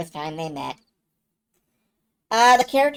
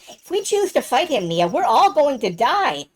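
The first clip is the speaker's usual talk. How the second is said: raised, emphatic voice of a worried friend; anxious Nash pleading; 6 semitones higher than usual. Acted. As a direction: plead with rising urgency, voice swinging high.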